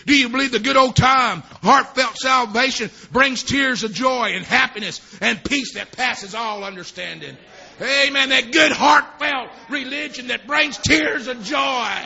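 loud, rousing revival preacher; ringing, drawn-out pulpit delivery; fervent preaching. Acted. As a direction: preach it out with full force. Preaching at full force.

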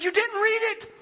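very high, astonished imitation of a wife; incredulous wife. Wife finds out he never read it, incredulous. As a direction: squeal it in retold disbelief. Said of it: "She said".

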